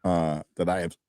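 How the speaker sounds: speaking with hiccups. Real, not acted. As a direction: talk through hiccups. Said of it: "God, I got the hiccups".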